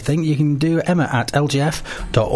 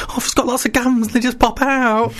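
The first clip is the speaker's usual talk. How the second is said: imitating Stacey; 12 semitones higher than usual. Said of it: "She says".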